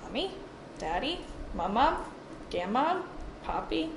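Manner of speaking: little one naming faces, each word rising; high rising lilt of a small child; toddler naming relatives. Retold or imitated. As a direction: softly imitate a toddler, lifting each name. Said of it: "he'll sit there".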